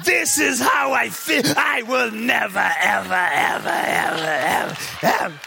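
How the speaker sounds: lightly voiced petty reviewer, stretched out; mock angry reviewer. Pastor mocking a bitter reviewer to the church, hammed-up. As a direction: ham up the reviewer dragging out never ever.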